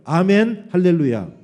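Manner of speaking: loud 'amen, hallelujah' for repeating; loud call-and-response lead; leading congregational response. Shown as a demonstration. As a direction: call it out firmly for them to echo. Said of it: "여러분 따라해보세요".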